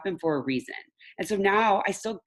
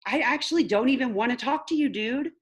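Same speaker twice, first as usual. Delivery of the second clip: high, upset plea; a grieving owner demanding her dog back; pleading for her dog; 8 semitones higher than usual. Retold or imitated. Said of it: "And then I'm like".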